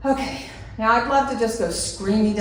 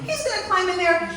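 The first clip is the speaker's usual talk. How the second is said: much higher, laughing panic; panicked thought; 10 semitones higher than usual. Retold or imitated. Fearing the rat gets in, laughing high panic. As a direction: fret aloud half laughing. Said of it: "I'm like".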